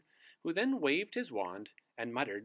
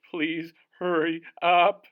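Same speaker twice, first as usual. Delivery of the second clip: loud pleading shiver of a freezing man; shivering plea; 6 semitones higher, 13 dB louder than usual. Acted. Freezing Mister Sneeze begs the wizard, loud shivering plea. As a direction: beg through the cold, teeth chattering. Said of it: "shivered Mister Sneeze".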